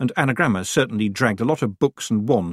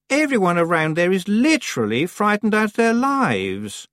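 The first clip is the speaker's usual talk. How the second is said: raised, superior witch; snooty girl voice; 11 semitones higher than usual. A narrator's reading. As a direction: say it disapprovingly. Anagrama voiced in story.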